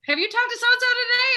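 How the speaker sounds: high, put-on worried question; worried mother.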